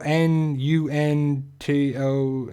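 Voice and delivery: slow, letter by letter, each one separate; spelling out letters. Shown as a demonstration. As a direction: spell the name out slowly, unsure how to say it. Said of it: "I don't know how to say this name".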